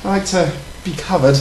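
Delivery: prim, proper British lady voice; British accent, ladylike; put-on British accent. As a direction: play a posh English lady flirting. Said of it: "the accent is annoying the crap out of me".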